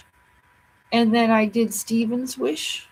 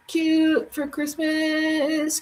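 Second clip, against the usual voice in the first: singsong cute; 8 semitones higher, much slower than usual. Pretend. Repeating cute phrase playfully.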